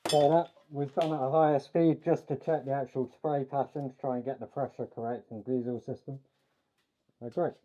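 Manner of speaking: talking through a face mask; muffled voice. Real, not acted. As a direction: speak muffled behind a mask. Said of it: "sorry for the muffled voice".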